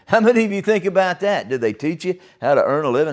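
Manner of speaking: usual speaking voice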